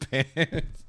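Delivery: ordinary talk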